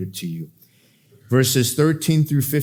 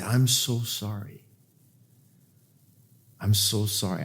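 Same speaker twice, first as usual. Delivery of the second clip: tender sympathy; 3 semitones lower, 7 dB quieter than usual. Retold or imitated. Holding crying friend, retold tender sympathy. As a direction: console a friend quietly, unhurried.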